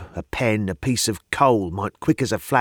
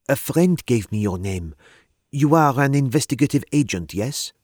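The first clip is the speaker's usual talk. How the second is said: put-on French accent; 4 semitones higher than usual. A narrator's reading. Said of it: "her accent she was from the continent".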